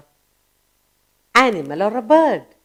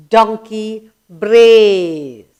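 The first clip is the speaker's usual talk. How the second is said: "brays" stretched very long and loud; very slow, with a long swelling "brays"; drawn-out brays; 7 dB louder, much slower than usual. Shown as a demonstration. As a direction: hold the vowel of "brays" like a donkey's call. Teacher repeats the donkey's sound word.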